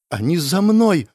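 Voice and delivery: scared, not truly whispered; frightened. A narrator's reading. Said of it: "испуганно прошептала Линет".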